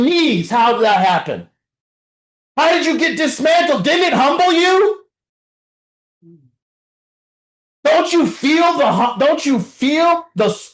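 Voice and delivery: high, shouted, fiery preaching; preacher shouting down the room in recovery; preaching at full force. Real, not acted. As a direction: hammer them with the truth. Preacher confronts addicts, full force.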